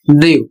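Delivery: ड्यू said as "dyoo", dropping low at the end; ड्यू, d then a long yoo; model pronunciation. Shown as a demonstration. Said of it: "इसका उच्चारण होगा". (आदर्श उच्चारण: ड्यू, नीचे उतरते सुर में)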